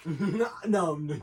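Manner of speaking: normal talking voice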